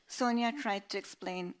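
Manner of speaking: plain reading voice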